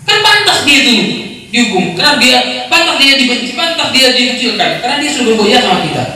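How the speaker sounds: mock the self-righteous crowd's complaint; lightly acted bitter voice; resentful accuser. Voicing people who want revenge.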